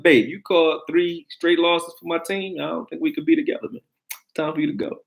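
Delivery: high, mock breakup speech to his girl; talking to his girl. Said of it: "I'm like".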